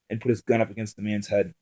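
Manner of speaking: plain reading voice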